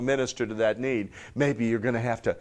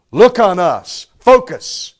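loud, punched order to pay attention; forceful apostle's summons; bold command; 7 semitones higher, 13 dB louder, slower than usual. Acted. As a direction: demand his full attention. Acts out Peter commanding the man.

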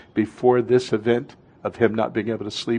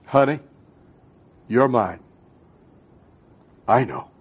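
tender Lord's voice; 3 dB quieter than usual. Acted. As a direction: speak it softly, as God comforting a child. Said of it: "the Lord says".

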